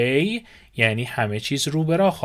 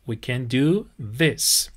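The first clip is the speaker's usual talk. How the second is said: very slow with a rising end; slow model phrase; much slower than usual. Shown as a demonstration. Teacher offering the phrase to students, very slow. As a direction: stretch each word for learners.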